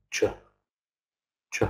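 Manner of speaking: "ch" as a tiny unstressed blip; reduced second syllable. Shown as a demonstration. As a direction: cut "ch" down to a quick, weak "chuh". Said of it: "The second syllable is just".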